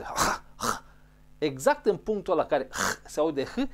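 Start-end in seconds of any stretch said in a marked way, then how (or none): 0.0-0.8 rasped H from the top of the throat; "Ha" with a harsh, scraping throat friction; throat-clearing H. Shown as a demonstration. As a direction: say "Ha" like clearing something stuck in the throat. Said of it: "vrei să-l elimini pentru că te deranjează".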